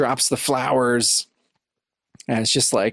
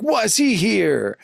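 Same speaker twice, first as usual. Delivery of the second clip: higher, slow jealous question; suspicious Zapper; 8 semitones higher, much slower than usual. Retold or imitated. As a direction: drag it out, pitched up and wounded.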